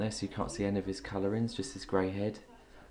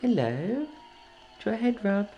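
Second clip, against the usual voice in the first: pet talk; 9 semitones higher than usual. Real, not acted. Owner offering a parrot a head rub, coaxing. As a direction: coo it brightly up high to a bird.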